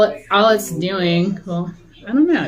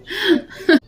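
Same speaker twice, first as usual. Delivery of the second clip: laugh; 10 semitones higher than usual. Real, not acted. Laughing at own forgetfulness.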